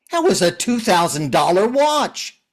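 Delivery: loud, high protest; loud upset over the thrown watch; shocked outrage. Acted. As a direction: cry out at what he did. After watch tossed in water.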